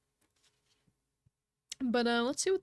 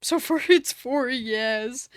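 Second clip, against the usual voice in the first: laughing; 9 dB louder, slower than usual. Real, not acted. Laughing reading poll result.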